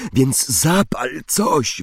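lightly acted choking urgency; choked out. A narrator's reading. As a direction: force it out, stretched, as if squeezed. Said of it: "wykrztusił Harry".

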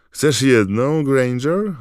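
loud, high-pitched taunt; taunting offer. A narrator's reading. Malfoy offers Hermione a badge, high loud taunt. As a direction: goad her with a mock offer.

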